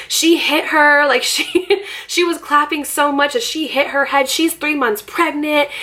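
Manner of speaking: high, overwrought mock outrage; dramatic playing-up. Mom telling security the played-up story, hammed-up outrage. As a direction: pitch it up like an exaggerating accuser. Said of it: "played up the story a little bit".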